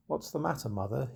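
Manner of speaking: soft, gentle question. Acted. Robin gently asking the sobbing old woman what's wrong. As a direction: speak softly to comfort her. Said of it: "he said in a gentle tone".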